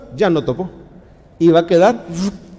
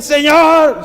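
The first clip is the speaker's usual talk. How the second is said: clamor urgente; 9 semitones higher, 5 dB louder than usual. Shown as a demonstration. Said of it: "No, no dio tiempo".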